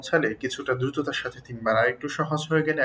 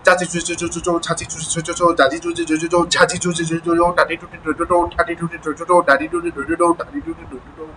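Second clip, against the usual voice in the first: very quick, rhythmic tongue-twister run; speeded-up drill. Shown as a demonstration. Teacher pushing the drill as fast as possible, speeded-up reading. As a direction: race through the syllable lines without stopping. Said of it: "গতি বাড়াতে হবে, যত বাড়াতে পারেন".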